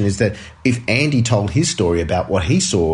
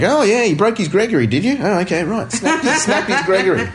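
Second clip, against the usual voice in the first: higher-pitched pretend nod-along; mock casual understanding; 9 semitones higher than usual. James bluffing through Cockney slang, mock casual understanding. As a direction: act as if following slang, breaking into laughter. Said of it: "maybe he just nods his head and says".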